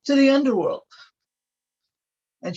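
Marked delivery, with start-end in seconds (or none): 0.0-0.8 much higher and louder, an angry command; furious banishing. Acted. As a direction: cast him out with full fury. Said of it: "she was rightfully pissed".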